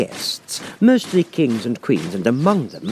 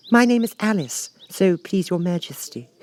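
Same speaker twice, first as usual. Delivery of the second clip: put-on a lightly higher, polite girl's voice; polite Alice; 10 semitones higher than usual. A narrator's reading. Alice to the Queen, mildly polite and proper. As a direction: answer the queen with careful manners. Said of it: "said Alice very politely".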